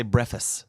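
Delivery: breakfast said as "breffis"; slurred variant pronunciation. Shown as a demonstration. Co-host reporting how people say it, breakfast as "breffis". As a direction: leave the K out of breakfast, "BREFF-iss". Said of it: "there are people who say".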